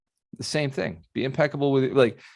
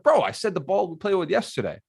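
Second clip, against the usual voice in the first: higher, exasperated retort to his brother; frustrated at brother; 7 semitones higher than usual. Retold or imitated. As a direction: tell him off in mild exasperation. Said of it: "And I got mad".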